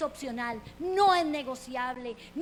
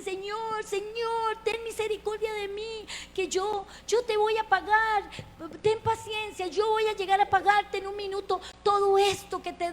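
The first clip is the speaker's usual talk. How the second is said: high, loud, pleading cry for mercy; desperate begging; 7 semitones higher, 5 dB louder than usual. Acted. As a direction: beg the king for mercy on your knees. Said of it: "se tira al suelo y le ruega".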